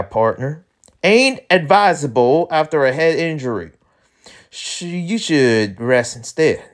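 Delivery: slow, folksy country drawl; stretched-out Southern villain voice; Southern cowboy character. Acted. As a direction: drawl it like an old-time cowboy. Voices Montresor.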